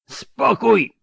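even harder, very high roar. Acted. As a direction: bellow over the tumult. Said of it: "ryknął jeszcze głośniej Danza".

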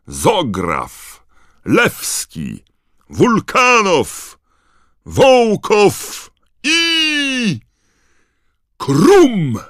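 name calls climbing to a high shout; announcer's build-up. A narrator's reading. Bagman introducing Bulgaria up to Krum, escalating shout.

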